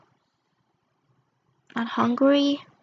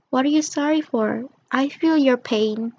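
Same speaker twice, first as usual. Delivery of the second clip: normal talking voice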